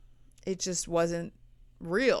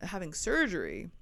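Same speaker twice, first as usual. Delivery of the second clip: ordinary talk, nothing marked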